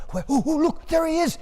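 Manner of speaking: high, eager calls of a crowd craning to see; excited crowd. Acted. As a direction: point and cry out, high and thrilled. Crowd spots the farmer's arrival.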